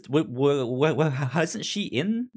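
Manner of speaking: usual speaking voice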